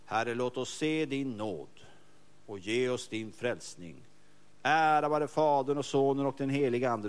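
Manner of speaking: slow, solemn liturgical prayer; slow and solemn, reciting to God; slow prayer recital. Shown as a demonstration. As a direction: intone it slowly like morning prayer. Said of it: "Så börjar han".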